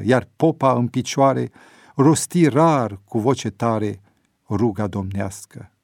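ordinary delivery

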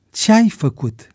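troubled. A narrator's reading. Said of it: "îl întrebă el tulburat".

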